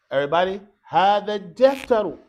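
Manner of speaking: loud classroom model for repetition; loud teacher leading a chant-back drill; calling out a drill. Shown as a demonstration. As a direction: project it for the class to repeat.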